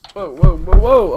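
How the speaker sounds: loud, high whoas, really startled; startled shout. Real, not acted. Streamer jolting after bumping his mic, loud startled shout. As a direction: yelp in real alarm. Startled, hits pop filter.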